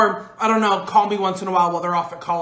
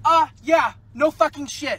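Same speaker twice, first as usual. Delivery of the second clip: sarcastic annoyed; 6 semitones higher, 3 dB louder, slower than usual. Acted. Stuck car argument.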